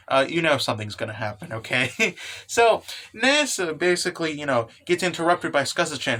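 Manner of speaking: usual speaking voice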